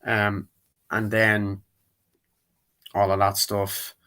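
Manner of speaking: usual speaking voice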